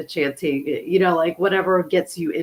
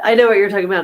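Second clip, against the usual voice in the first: ordinary talk, nothing marked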